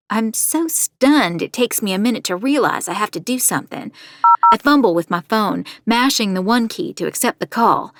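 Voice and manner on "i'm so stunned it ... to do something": plain reading voice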